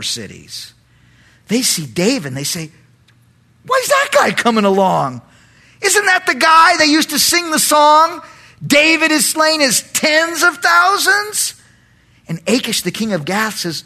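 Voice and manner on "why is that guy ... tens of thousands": high, rising mock indignation; indignant. Philistine leaders seeing David come along, indignant. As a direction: protest it loudly, in disbelief.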